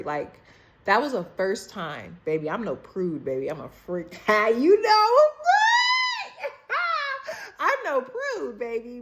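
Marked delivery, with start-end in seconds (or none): 4.2-9.0 high squealing laugh through the words; laughing hard. Real, not acted. Laughing to her friend at her own confession. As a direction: crack up at your own joke.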